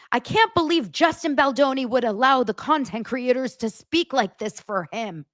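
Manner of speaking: high-pitched mock outrage; mocking imitation. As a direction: parrot the complainers in a high mocking voice. Mocking re-quote of complaining commenters.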